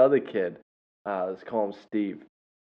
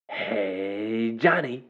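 drawn-out hey, then the name pops up; imitated kid greeting; much slower than usual. Student runs up to Mister John, drawn-out catchphrase greeting. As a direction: lightly mimic a kid's catchphrase greeting. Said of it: "and he says".

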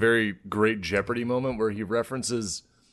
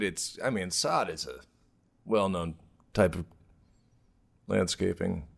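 losing confidence mid-sentence, quieter; trailing off; 3 semitones lower, 7 dB quieter than usual. Real, not acted. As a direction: voice fading away, unsure. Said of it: "you really kinda trailed off".

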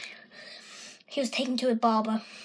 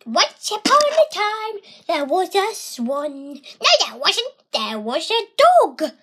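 lively, contradicting storytelling; story tug of war; arguing storyteller; 6 semitones higher, 4 dB louder than usual. Acted. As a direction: tell it with playful squabbles. Two-voice story game.

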